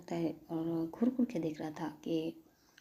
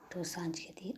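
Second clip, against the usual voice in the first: ordinary delivery, nothing marked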